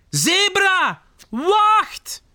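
high, urgent holler; calling out. Acted. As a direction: call after someone walking away. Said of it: "roept hij". (hoog en dringend naroepen)

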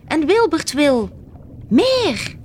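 high-pitched alarm call. A narrator's reading. Little crab alerting the others to the whale, crying out high. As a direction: cry it out high, landing hard on the last word. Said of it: "riep Klaartje".

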